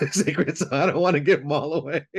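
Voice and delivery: laughing through the words. Real, not acted. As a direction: break into a laugh. Host laughs at joke.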